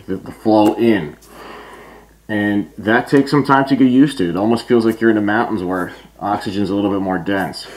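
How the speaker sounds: muffled behind mask filter; speaking inside respirator; through a gas mask. Real, not acted. Seen 1: Full-face gas mask with filter worn throughout; reference frames show none.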